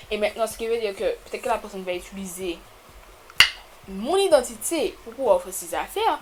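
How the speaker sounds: usual speaking voice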